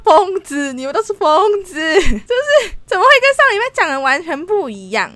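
loud, high exasperated outburst; inner rage. Retold or imitated. Retelling her silent thoughts at the boss, exasperated shout. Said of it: "然后我想说".

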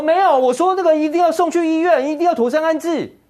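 high, mocking imitation of an official; mocking the minister's words. Mocking the minister's changed story, sarcastic high imitation. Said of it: "五月十九号你说".

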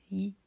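a short, clipped 咦; 咦 as a brief y glide; phonetic sound demo. Shown as a demonstration. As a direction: recite 咦 quickly, on its own. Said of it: "老柯从第一个再讲一次".

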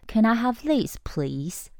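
sweet polite request. Shown as a demonstration. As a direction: ask nicely. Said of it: "才满分哦".

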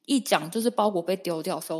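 ordinary talk, nothing marked

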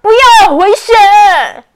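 loud, high alarmed cry; shouting. Acted. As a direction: yell a warning. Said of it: "欧奇大叫着".

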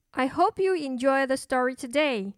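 usual speaking voice

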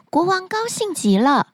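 plain reading voice

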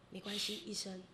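steady and quiet, no wavering; calm resolve. Retold or imitated. Mom telling the doctor not to operate, quietly resolute.

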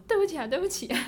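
a scared boy apologizing, lightly voiced; hurried mock apology. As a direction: beg off in a hurry.

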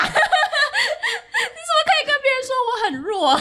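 high, slow, indignant laughing outburst; exploding boyfriend. Acted. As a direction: wail it out as the offended boyfriend. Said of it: "男友就会爆炸".

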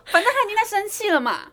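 high, loud words breaking into laughter; laughing while talking. Real, not acted. Teacher laughing while telling friend about teasing a pupil. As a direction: laugh through it at your own mischief.

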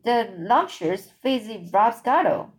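ordinary delivery

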